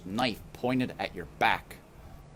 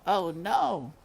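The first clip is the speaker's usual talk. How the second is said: ordinary delivery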